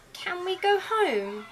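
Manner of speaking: high, slow little chick; tired owlet asking to go home. Acted. Tired Little Owl voiced.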